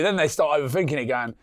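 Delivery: ordinary talk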